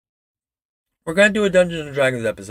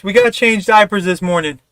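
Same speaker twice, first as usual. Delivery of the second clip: ordinary delivery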